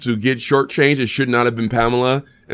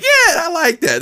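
high, drawn-out cheer of approval; pleased approval; slower than usual. Acted. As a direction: stretch it out like a satisfied fan. Voicing a pleased moviegoer.

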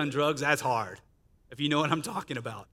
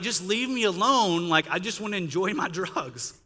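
mock exasperation, raised voice; exasperated plea; 5 semitones higher, 4 dB louder than usual. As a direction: imitate whining at God to back off. Retells pleading with God, exasperated.

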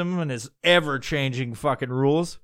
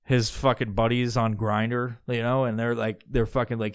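normal talking voice

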